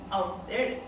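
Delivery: usual speaking voice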